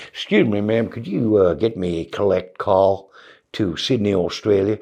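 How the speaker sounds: low-pitched, polite mock American accent; put-on American accent. Comedian asking operator for a collect call, low polite American accent. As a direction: ask courteously in an imitated American accent. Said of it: "the accent to these guys".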